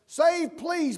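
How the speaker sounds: high, loud Save; imitating a crowd cry. Pastor voicing the Palm Sunday crowd, loud cry. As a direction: shout it out like the crowd. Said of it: "but they are saying it like this".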